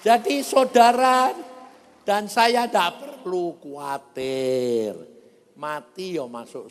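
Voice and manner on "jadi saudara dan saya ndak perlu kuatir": loud, stretched-out pulpit cry; ringing call to the congregation; preaching proclamation. Real, not acted. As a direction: proclaim it at full force, starting high and descending.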